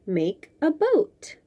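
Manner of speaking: usual speaking voice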